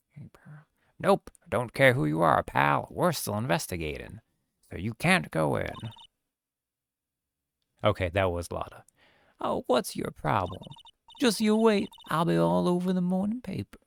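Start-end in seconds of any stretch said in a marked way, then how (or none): 0.1-0.7 breathy whispered hello to Pearl; whispered greeting. Acted. Lotta whispering hello to Pearl. 1.0-5.7 a hint of a blustering cop voice; lightly voiced detective shooing her off; gruff cop. Gumshoe telling Lotta she can't go in, lightly gruff. 9.4-13.8 southern reporter. Acted. Lotta threatening Gumshoe, high and sassy.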